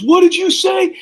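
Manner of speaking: shrieking, scandalized teacher; outraged teacher. Retold or imitated. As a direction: gasp out in disbelief. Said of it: "She goes".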